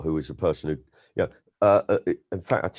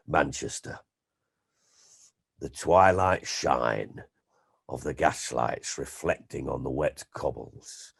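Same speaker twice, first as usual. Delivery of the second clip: lightly mock-grand, slow and softer Les Dawson prose; florid storyteller; 5 semitones lower, 5 dB quieter, slower than usual. A comic reciting a comedian's purple prose to a friend, mock-grand and slow. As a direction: savour each ornate phrase, slowly and quietly.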